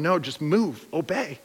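soft, quiet urging; gentle God voice. Retold or imitated. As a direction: coax them gently forward. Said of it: "And he's going".

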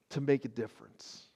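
usual speaking voice

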